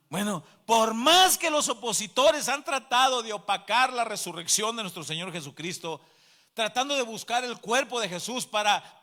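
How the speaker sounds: loud, emphatic sermon delivery; full-force preaching. Real, not acted. Preacher defying those who deny the resurrection, loud and emphatic.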